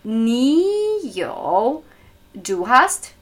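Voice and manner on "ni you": careful Mandarin tones on Ni you; second and third tone. Shown as a demonstration. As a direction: draw Ni upward, then dip on you. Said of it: "zweiter und dritter Ton".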